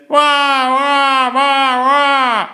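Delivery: put-on very high loud baby wail; grown man wailing. A narrator's reading. Principal wailing at the enormous needle. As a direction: howl like a scared baby. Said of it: "and he said".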